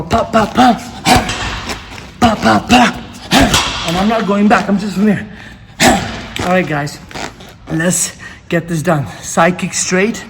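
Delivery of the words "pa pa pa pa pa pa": rhythmic pa pa strikes; punch sounds. Shown as a demonstration. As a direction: mimic quick punches. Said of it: "You go".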